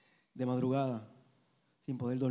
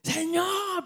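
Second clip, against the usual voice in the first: crying out to God, much higher; a desperate call of Lord, pitched way up; retold desperate cry; 4 dB louder than usual. Husband recalls his cry to the Lord, high desperate call. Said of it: "Le grité".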